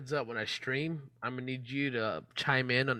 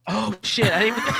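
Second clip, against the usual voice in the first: higher, louder cry breaking into laughter; real laughing outburst; 11 semitones higher, 6 dB louder than usual. Real, not acted. Streamer caught by his own mishap, laughing outburst. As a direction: blurt it out loud through real laughter.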